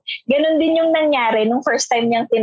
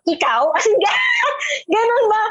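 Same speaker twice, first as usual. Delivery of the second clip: incredulous; 9 semitones higher than usual. Retold or imitated. Retold classmates doubting her.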